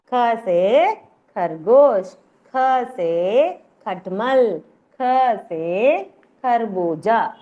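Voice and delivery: slow, stretched syllables for the class to follow; slow drill. Shown as a demonstration. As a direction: recite each letter and word very deliberately. Teacher models letter-word drill.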